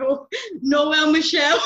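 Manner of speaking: loud, laughing blurt; blurted gaffe. Retold or imitated. Student retelling her slip to the tutor, loud laughing blurt.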